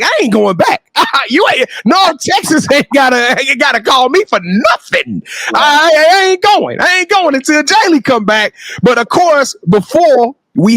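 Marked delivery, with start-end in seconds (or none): 0.0-8.5 defiant refusal. Retold or imitated. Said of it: "I was like".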